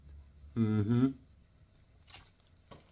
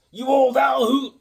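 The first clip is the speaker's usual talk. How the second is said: higher and louder, mock frontier drawl; old-west cowboy; 10 semitones higher, 7 dB louder than usual. Ribbing Billy the Kid like a cowpoke. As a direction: play a grizzled cowpoke ribbing a pal. Cowboy talk after whoop.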